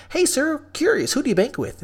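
high, sugary polite greeting; customer-service voice. Retold or imitated. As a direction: sell it like a chirpy bank teller. Retold polite bank greeting.

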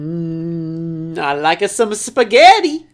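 slow, hammed-up Italian accent; hum, then savour it in mock-Italian; drawn-out cartoon Italian. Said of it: "Are you part Italian?".

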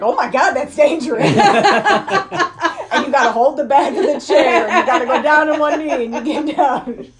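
high, loud, laughing mock panic; panicky forty-year-olds. Retelling a comedian's bit about forty-year-olds on a chair, mock panic. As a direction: fret comically, breaking into laughter. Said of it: "all the forty-year-olds are like".

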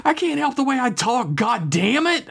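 high-pitched defensive yell; exasperated outburst without much extra volume; yelling back. A narrator's reading. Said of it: "I yelled back".

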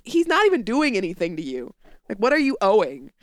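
ordinary talk

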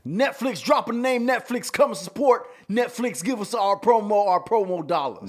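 high, loud mock plea to Netflix; loud begging. Pleading to Netflix for promo.

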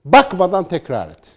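loud, high and slow, daring them to read it; challenging command. Pretend. Commentator daring critics to recite a line, loud and slow. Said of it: "de ki".

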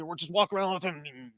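zombie. Retold or imitated. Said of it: "good impression of a zombie".

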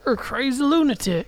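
high, put-on panicky voice; mock frightened NPC shriek; squeaky scared NPC. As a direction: squeal it like a terrified villager. Scared NPC insulting player.

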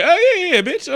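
very high, hammed-up yeahs; mock eager reply. Peggy answering over the radio, mock eager squeal. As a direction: start high and loud, then ease off.

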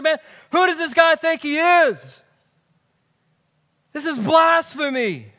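indignant religious leaders. Retold or imitated. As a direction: play the fuming scribes, affronted. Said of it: "they're ticked off".

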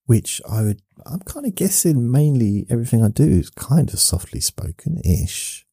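ordinary delivery

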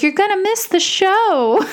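high, hammy ghostly taunt; cheesy ghost voice. Retold or imitated. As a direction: tease it like a campy ghost. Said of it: "Like, it's so cheesy".

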